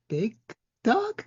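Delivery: "Big... dog?" with "dog" leaping very high; questioning. Retold or imitated. Listener mimics Punk's doubtful title for Roman, questioning "dog?". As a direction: break after "Big", then squeak "dog?" in doubt. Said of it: "he questioned that one".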